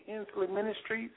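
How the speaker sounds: ordinary talk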